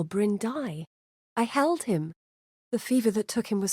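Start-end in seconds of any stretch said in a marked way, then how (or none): none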